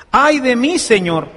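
usual speaking voice

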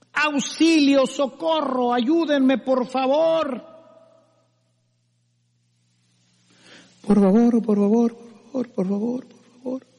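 a stranded climber begging, getting weaker; desperate cry for help. Acted. Fallen climber calling for help from the cliff, desperate plea fading. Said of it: "Y empieza a gritar".

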